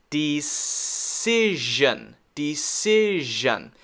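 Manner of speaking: "decision" drawn out as dee-SIIZH-un; very slow "decision" for learners to copy; slow model. Shown as a demonstration. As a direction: stretch "decision", holding s and zh. Teacher models the word slowly.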